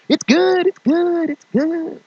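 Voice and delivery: very high forced cheery squeak; fake it's good. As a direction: insist all is fine, far too brightly.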